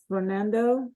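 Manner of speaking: ordinary talk, nothing marked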